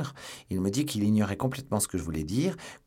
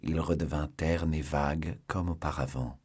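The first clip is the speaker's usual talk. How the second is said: ordinary delivery, nothing marked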